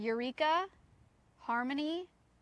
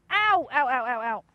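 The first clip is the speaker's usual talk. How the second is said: pained yelps; 9 dB louder, slower than usual. Real, not acted. Owner hurt while handling Beetle, pained yelps. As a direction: yelp a quick string of ows.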